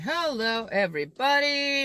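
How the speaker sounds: cheery greeting. Real, not acted.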